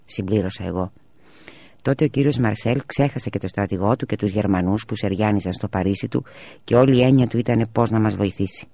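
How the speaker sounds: ordinary delivery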